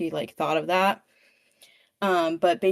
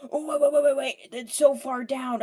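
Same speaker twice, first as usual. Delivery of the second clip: higher, anxious cry of wait, wait; alarmed warning; 7 semitones higher than usual. Retold or imitated. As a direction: stop her before she jumps, worried. Villain stops Evie from jumping.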